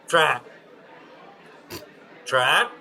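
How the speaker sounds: high, loud mocking call of Trot; heckling fan calls. Fan in the stands calling to the player, high and loud. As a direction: bellow Trot across the outfield like a pest.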